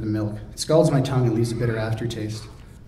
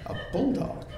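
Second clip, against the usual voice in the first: breathy whisper, pitched much higher; incredulous scoff; 12 semitones higher, 6 dB quieter than usual. Acted. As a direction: scoff the word in disbelief. Mocking her own word.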